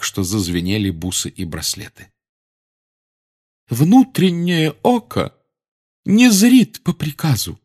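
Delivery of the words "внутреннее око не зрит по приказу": slow, high, louder affronted seer; proudly offended mystic. A narrator's reading. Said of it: "оскорблённо сообщила она".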